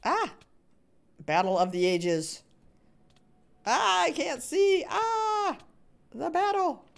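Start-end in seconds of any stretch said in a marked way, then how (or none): 3.7-6.8 excited shout. Real, not acted. Streamer can't see the fight, high excited wail. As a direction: wail that the view is blocked.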